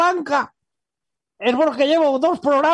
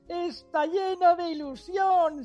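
voz de José Luis imitada; 7 dB quieter than usual. Retold or imitated. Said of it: "seguro que estará diciendo".

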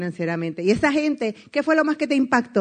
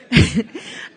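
short burst of nervous laughter; 4 dB louder than usual. Retold or imitated. Nervous laugh retelling being stuck in the car. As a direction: laugh from nerves. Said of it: "me empiezo a reír porque los nervios".

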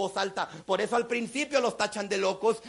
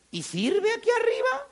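higher, surprised question from the stranger; amazed seatmate; 12 semitones higher than usual. Retold or imitated. Passenger next to him asks, higher and amazed. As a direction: play the astonished passenger.

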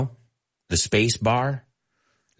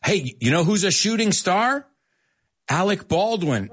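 ordinary talk

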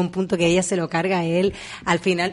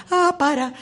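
mock shock; 9 semitones higher, slower than usual. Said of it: "es como".

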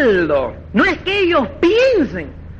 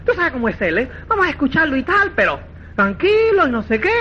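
hurried soothing quote; 4 semitones lower, faster than usual. Retold or imitated. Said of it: "A decirle".

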